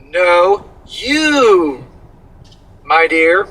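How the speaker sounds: ordinary talk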